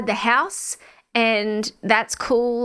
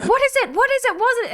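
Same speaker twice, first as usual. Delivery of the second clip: frantic mum; 13 semitones higher, faster than usual. Retold or imitated. A mum hearing screams from downstairs, panicked and high. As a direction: squeal it like a startled mother. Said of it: "she's like".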